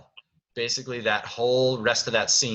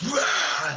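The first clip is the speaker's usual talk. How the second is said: raised, loud mock roar; imitated roar; 6 semitones higher, 5 dB louder than usual. Director roars as Beast startling Belle, short loud roar. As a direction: roar suddenly, as a jump scare. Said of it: "so he roars".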